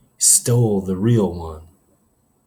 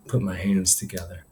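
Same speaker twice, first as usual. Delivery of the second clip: ordinary delivery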